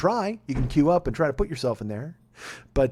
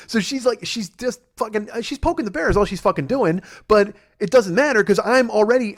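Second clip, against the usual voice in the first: ordinary talk, nothing marked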